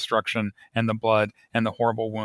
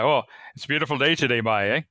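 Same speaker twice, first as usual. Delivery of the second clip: quick, light Newfoundland lilt on "by, eh"; a hint of Newfoundland dialect, tossed off fast; Newfoundland accent; 3 semitones higher, faster than usual. Shown as a demonstration. Author demoing Newfoundland speech to interviewer, light Newfoundland accent. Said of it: "So by".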